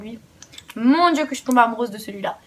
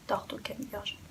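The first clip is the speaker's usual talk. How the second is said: Tarto Canneberge in a soft unvoiced whisper; whispered repeat; 3 semitones lower, 16 dB quieter than usual. Shown as a demonstration. Repeating the name for a friend, whispered.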